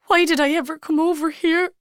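a sudden tearful wail; through tears. Acted. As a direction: burst out crying the question. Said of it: "she suddenly said through her tears".

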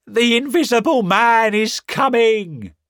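high, loud panicked yell; terrified shout. Acted. Marvel shouting warning to the town, high panicked yell. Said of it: "he shouted".

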